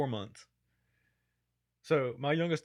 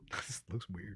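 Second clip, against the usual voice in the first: whisper; 4 semitones lower, 9 dB quieter, slower than usual. Real, not acted. Laughing aside to co-host.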